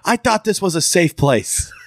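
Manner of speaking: mock-indignant, high-pitched protest. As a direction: imitate a friend's high, affronted outburst. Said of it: "he got, like, indignant".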